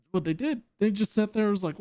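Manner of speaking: ordinary delivery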